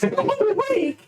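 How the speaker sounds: high, squeaky burst of insistence; hammed-up exasperated dad; insistent dad. Dad insisting on the boy's training.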